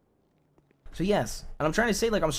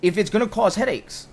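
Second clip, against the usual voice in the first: raised, higher-pitched arguing voice; heated insistence; 5 semitones higher, 6 dB louder than usual. Real, not acted. Offering partner to drop the joint deal, heated insistence. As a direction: push back loudly.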